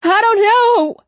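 very high, loud desperate yell. Acted. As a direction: yell it out in pain. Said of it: "he yelled".

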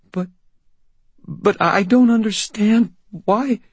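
put-on high, slow, scared girl voice; frightened girl. Sarah frightened, stammering.

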